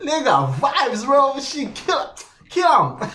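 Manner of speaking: high, excited reaction breaking into laughter; amused hype. Real, not acted. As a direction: hype it up, tickled.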